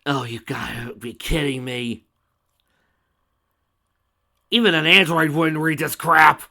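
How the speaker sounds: loud fed-up complaint; exasperated. Real, not acted. Exasperated reader commentary.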